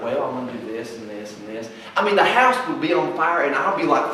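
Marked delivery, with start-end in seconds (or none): 0.0-1.7 low, soft, easygoing; laid back. Acted. Laid-back brother answering the panicked sibling, unbothered. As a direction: amble through it, unbothered. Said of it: "he's just so laid back".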